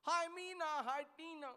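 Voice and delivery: high, loud mock-girly hello; girlfriends greeting. New English-medium girlfriends greeting at the bus stand, mock girly. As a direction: call out like two excited girlfriends.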